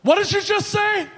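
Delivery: high, loud, furious challenge; a pissed-off stranger confronting him; angry demand. Retold or imitated. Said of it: "he was so pissed".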